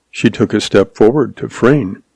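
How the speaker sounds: plain reading voice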